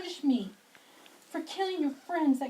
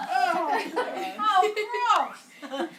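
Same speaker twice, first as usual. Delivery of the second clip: laughing disgust; 5 semitones higher, 6 dB louder, slower than usual. Acted. Reacts to the severed arms.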